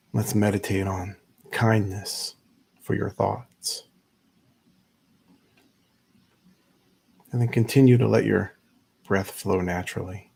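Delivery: unhurried meditation guide, long pauses between phrases; measured, spacious guiding voice; guided meditation. Acted. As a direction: ease listeners into stillness, leaving space after each instruction.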